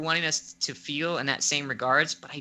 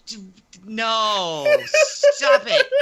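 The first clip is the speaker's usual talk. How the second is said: hammed-up disbelief, rising to a high, loud cry; dramatic refusal; 7 semitones higher, 9 dB louder, much slower than usual. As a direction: refuse the twist with mock outrage. Acted refusal to accept twist.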